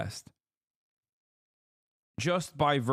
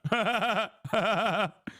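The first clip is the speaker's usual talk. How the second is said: laughter; 8 semitones higher than usual. Real, not acted. Laughing at own Scottish bit.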